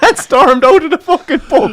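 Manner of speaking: high, loud, wheezing with laughter; real helpless laughter through the words; laughing hard. Real, not acted. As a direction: finish the story while cracking up.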